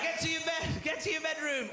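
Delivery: parent yelling. Retold or imitated.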